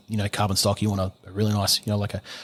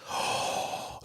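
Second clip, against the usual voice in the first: sharp unvoiced mock gasp; 11 dB quieter than usual. Mocking the police finding an unregistered gun, a staged gasp. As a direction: gasp in fake horror.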